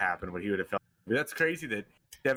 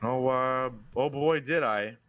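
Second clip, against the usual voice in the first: sarcastic; slower than usual. Real, not acted. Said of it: "are you just being sarcastic".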